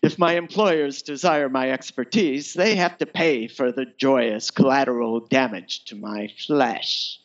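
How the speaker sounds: ordinary delivery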